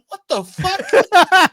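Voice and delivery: very high, loud, slow what the fuck; stunned disbelief. Retold or imitated. Co-host recalls reacting to the cop shooting the cocaine, shrieking. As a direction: stretch it out in shock. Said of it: "I'm like".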